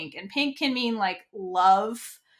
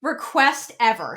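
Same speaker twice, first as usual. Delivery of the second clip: ordinary talk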